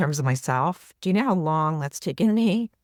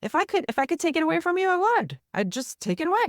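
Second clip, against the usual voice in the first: much higher, gentle coaxing tone; soothing therapist voice; 13 semitones higher than usual. Retold or imitated. Therapist soothing a tearful client, high and gentle.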